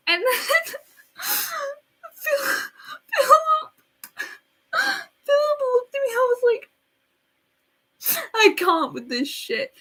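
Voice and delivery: high, halting tearful squeal; squeaky sobbing voice. Retold or imitated. Girl retelling Philip watching her cry, high squeaky sobbing. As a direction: choke out the name through high sobs.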